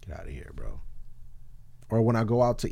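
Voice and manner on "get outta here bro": low muttered dismissal. Retold or imitated. Dismissive aside.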